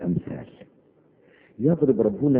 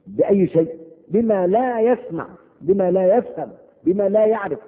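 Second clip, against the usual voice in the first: higher, louder shepherd's call; raised herding cry; 10 semitones higher, 4 dB louder than usual. Retold or imitated. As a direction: call out like a shepherd to his flock. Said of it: "يصيح".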